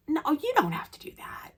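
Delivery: whispered, fluttered decline; breathy polite refusal. Retold or imitated. Fan politely declining his ticket offer in a hush. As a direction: refuse softly, flustered.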